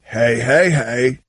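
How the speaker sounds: deep booming cartoon hey; very low drawn-out cartoon greeting; Fat Albert voice. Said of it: "[name]'s fake Fat Albert".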